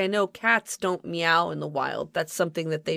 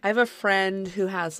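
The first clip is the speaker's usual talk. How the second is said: usual speaking voice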